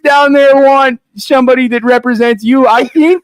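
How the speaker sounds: put-on high, loud Canadian accent; high Canadian pitch to the locals.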